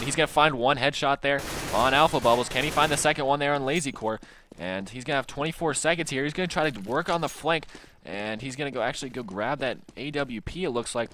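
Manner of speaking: building play-by-play hype; esports caster. Acted. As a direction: call the action, rising excitement.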